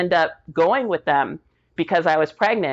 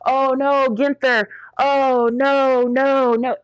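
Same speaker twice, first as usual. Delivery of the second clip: retold crying; 7 semitones higher, slower than usual. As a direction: wail his name, grief breaking through. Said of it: "start screaming and crying".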